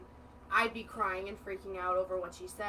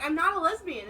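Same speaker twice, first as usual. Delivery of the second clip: higher indignant protest; 7 semitones higher, 6 dB louder than usual. Retold or imitated. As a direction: protest it, offended. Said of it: "I was so mad".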